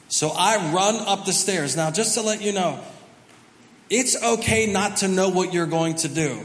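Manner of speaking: usual speaking voice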